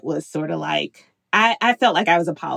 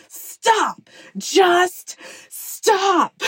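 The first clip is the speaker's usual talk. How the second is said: sharp high stop, much higher than her talk; retold yell; 11 semitones higher, much slower than usual. Mom in a work meeting yelling at the noisy room, high sharp stop. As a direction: bark it out to freeze the whole room.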